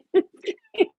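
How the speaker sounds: very high, loud cry-laugh wail; a shriek of relief that breaks into tears; overjoyed sobbing holler. Real, not acted. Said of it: "I just started hollering".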